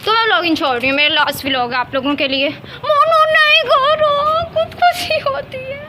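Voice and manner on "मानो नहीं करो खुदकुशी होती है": long, drawn-out high wail; squealed protest. Real, not acted. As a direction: wail slowly at the pet to stop. Begging pet to stop. (लंबी खींची हुई ऊँची चीख)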